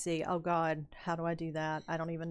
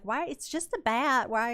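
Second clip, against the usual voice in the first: high, loud imitation of baffled onlookers; mock incredulous; 7 semitones higher, 6 dB louder than usual. Tour people questioning her fear of a bat, mock incredulous. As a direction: exclaim it as a puzzled protest, pitched up.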